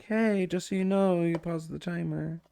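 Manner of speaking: mock a chatter's friendly correction; imitating a viewer. Streamer imitating a viewer pointing out the paused timer. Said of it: "you were gonna be like".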